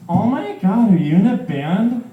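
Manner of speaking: put-on high gushing girl; gush, starstruck; excited girl voice. Girl voiced, excited about band.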